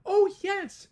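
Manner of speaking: high, loud piping voice; eager little mayor answering Horton; piped. Acted. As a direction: pipe it up like a tiny Who. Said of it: "piped the voice".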